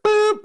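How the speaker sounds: squeaky high loud boop. Retold or imitated. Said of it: "and I was like".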